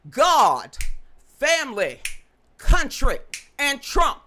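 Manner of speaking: God, family, country, and Trump shouted loud and proud; loud proud motto. Shown as a demonstration. To a live chat audience, shouting her motto loudly. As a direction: belt out the motto with pride. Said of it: "What's she about?".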